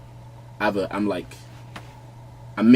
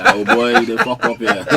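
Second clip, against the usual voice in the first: teasing Nigerian brother; 6 dB louder than usual. Retold or imitated. Mimicking Nigerian brothers mocking his England support, laughing. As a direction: ham up the scolding brother, laughing through it. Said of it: "start telling me".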